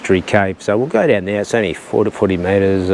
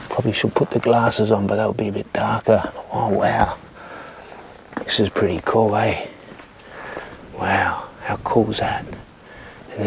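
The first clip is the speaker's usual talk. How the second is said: hushed, softer voice; real half-whisper; 6 dB quieter than usual. Real, not acted. Vlogger exploring inside a mystery cave, half-whispering. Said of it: "I don't know what I'm whispering".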